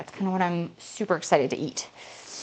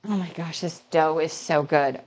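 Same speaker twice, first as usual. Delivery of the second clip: ordinary delivery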